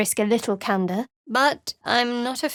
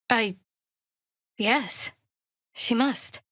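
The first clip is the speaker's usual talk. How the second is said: ordinary talk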